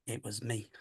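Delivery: put-on soft, small child's voice from the recording; a hushed little ghost voice answering. Recounting the spirit box to a friend, soft child voice. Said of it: "a little voice come through going".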